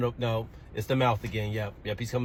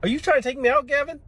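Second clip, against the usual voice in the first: much higher, louder alarmed question; 13 semitones higher, 6 dB louder than usual. Real, not acted. Teacher alarmed at child's pretend shooting. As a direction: yelp it in alarm.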